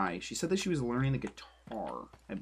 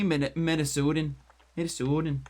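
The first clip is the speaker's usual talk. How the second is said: put-on Minnesotan accent, slightly raised and emphatic; 3 semitones higher, 5 dB louder than usual. Streamer sliding from Canadian into a mock Minnesotan accent. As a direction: lean into a playful Minnesotan accent. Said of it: "this is my more Minnesotan accent".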